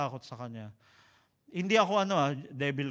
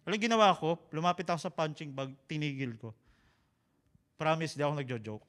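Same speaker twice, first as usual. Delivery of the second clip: ordinary talk, nothing marked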